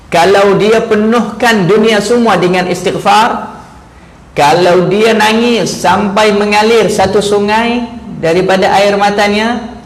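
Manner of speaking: rising fervent sermon voice; preaching at full force. Acted. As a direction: drive the warning home from the pulpit.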